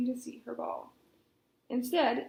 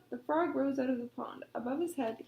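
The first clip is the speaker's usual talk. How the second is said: usual speaking voice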